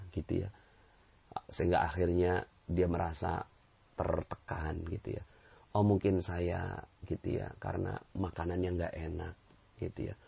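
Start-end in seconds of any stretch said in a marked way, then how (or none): none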